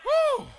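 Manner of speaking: high, loud exultant "woo"; preacher's whoop. Real, not acted. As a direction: let out a whoop after the climax. Preacher's whoop after the climax.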